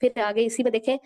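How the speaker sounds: ordinary talk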